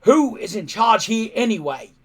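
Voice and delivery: loud, high furious roar. Acted. Chief Stoic losing his temper at his son, roaring loud. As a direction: roar out who's the boss. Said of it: "he roared".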